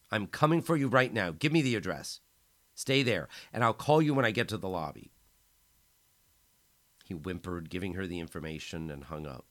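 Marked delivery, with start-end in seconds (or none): 0.1-5.1 louder, higher, hurried at the end; urgent rescuer on the phone; urgent and determined. A narrator's reading. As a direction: take charge, rushing to help. Friend promises rescue.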